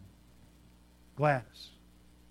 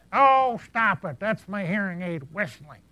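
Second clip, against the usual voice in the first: put-on mild old lady voice; 3 semitones higher, 7 dB louder, slower than usual. Gladys answers back.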